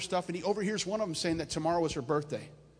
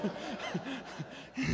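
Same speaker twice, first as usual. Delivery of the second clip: short chuckle at his own joke; soft real laughter; amused laugh between sentences; 5 semitones higher, 8 dB quieter than usual. Real, not acted.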